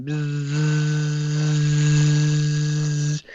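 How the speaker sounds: very long, steady buzzing drone; sustained insect-like bzzz; imitated buzz. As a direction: keep the buzz going on one note. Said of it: "it's just going".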